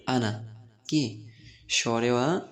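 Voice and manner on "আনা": আনা said with a short a, no stretched alif; short vowel. Shown as a demonstration. Teacher models আনা for students without the long vowel. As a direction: keep আনা clipped, no long টান on the a. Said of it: "উচ্চারণ আমার মতো করেই করেন".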